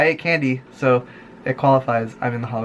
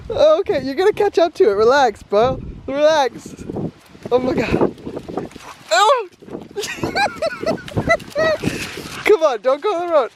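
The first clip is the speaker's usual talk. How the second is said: high excited calling; 14 semitones higher than usual. Real, not acted. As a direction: chase the puppy with your voice. Calling after the puppy.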